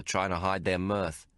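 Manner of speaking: plain reading voice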